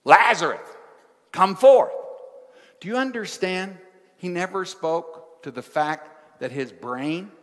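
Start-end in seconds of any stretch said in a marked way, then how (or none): 0.0-1.9 loud, higher ringing call of "Lazarus, come forth"; commanding call. Acted. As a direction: call the dead man out of the tomb. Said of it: "When Jesus says".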